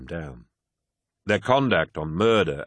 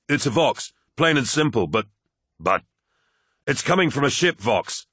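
put-on higher, urgent young officer; flight officer voice; 6 semitones higher, 3 dB louder than usual. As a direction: voice a tense, hurried officer.

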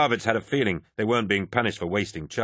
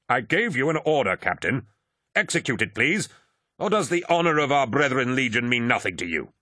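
higher-pitched, imperious commander's rebuke; stern lord commander; 5 semitones higher than usual. A narrator's reading. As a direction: pull rank on a questioning subordinate. Eidolon rebukes his captain.

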